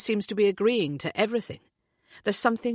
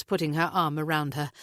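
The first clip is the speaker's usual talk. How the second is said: usual speaking voice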